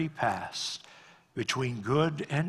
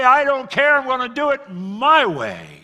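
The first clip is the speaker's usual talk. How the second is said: defiant retort; 11 semitones higher, 10 dB louder than usual. Retold or imitated. Defiant person quoted in sermon.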